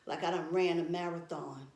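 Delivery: ordinary talk, nothing marked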